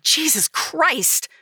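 high, slow exasperated cry; exasperated outburst. A narrator's reading. Narrator to Dustin, exasperated outburst. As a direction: throw it back at him in disbelief. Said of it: "I threw back".

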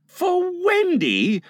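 slow, high-pitched disbelief; aghast. A narrator's reading. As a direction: stretch it out, aghast.

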